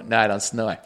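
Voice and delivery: mock him with a dumb low voice; mock denial. Roommate mimicking his cohost denying snoring, low dopey voice.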